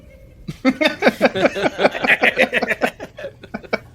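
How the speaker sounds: high, loud burst of laughter; big laugh. Real, not acted. The game master cracking up at his own covid joke, loud laughing.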